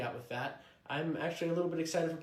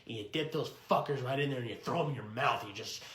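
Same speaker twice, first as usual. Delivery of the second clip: ordinary delivery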